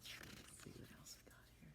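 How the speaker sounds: whispered, curious; storyteller whisper. Acted. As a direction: lean in and hush before the page. Turning page for children.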